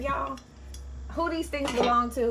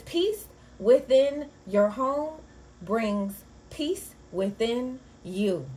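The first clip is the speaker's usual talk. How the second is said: slow quote read aloud; 2 semitones higher, 4 dB louder, much slower than usual. Shown as a demonstration. Vlogger reads the horoscope to Scorpios, slow read. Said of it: "it says".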